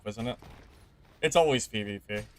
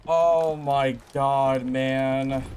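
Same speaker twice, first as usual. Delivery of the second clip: very slow, louder groaning oh my god; exasperated; 2 semitones higher, 4 dB louder, much slower than usual. Real, not acted. Streamer venting after a loss, slow loud groan. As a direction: stretch it out, fed up. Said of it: "I'm so mad".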